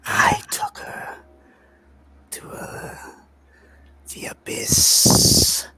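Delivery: slow, eerie whispered taunt; mocking creature whisper. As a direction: savor each word like a gloating horror. Creature taunts about Helene.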